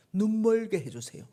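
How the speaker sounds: loud, raging plea to God; angry prayer. Retold or imitated. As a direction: hurl the curse out in fury. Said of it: "막 화를 내면서 이렇게 말하는 거죠". (하나님께 화내며 외치는 기도)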